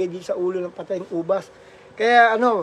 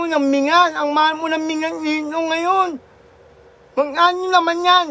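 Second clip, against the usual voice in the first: slurred cleft-palate speech, lightly raised; mock Ngongo voice; 6 semitones higher than usual. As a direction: imitate the Ngongo child calling home, slurring. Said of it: "yung kwento ni Ngongo".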